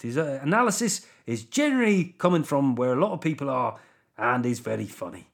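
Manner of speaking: comic attempt at a Welsh accent; put-on Welsh accent. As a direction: read the review in a wobbly Welsh accent. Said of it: "this Welsh accent".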